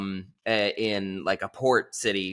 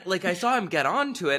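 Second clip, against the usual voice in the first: ordinary talk, nothing marked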